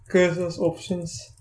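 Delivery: usual speaking voice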